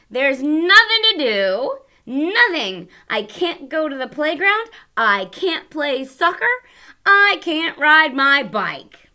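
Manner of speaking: loud, high, whining protest; whiny complaint. Acted. Teacher voicing the sulking boy complaining about rain.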